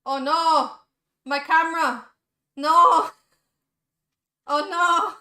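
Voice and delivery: repeated loud cries of alarm; loud dismay. Real, not acted. Streamer's camera fails, loudly dismayed. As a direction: cry out in mounting dismay.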